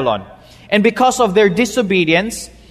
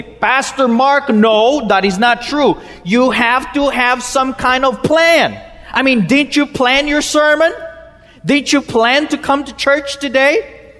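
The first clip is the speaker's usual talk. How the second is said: higher-pitched, insistent objection; protesting church planner; 6 semitones higher than usual. Acted. Preacher voices planners objecting to his sermon, protesting. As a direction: push back at the pastor with rising challenge. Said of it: "getting ready to stand up and say".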